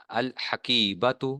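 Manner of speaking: الحقیبتو said slowly as al-haqeebatu, the l clear; الحقیبتو spoken slowly with a clear al; slow teaching model. Shown as a demonstration. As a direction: draw out الحقیبتو, sounding the lam. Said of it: "ال کی آواز آ رہی ہے".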